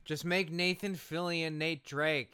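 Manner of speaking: ordinary talk, nothing marked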